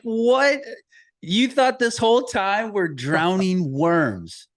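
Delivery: high, loud, laughing disbelief, stretched out slowly; amazed laughing disbelief. Retold or imitated. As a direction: gasp it out in laughing shock at your son. Dad's stunned reaction to son.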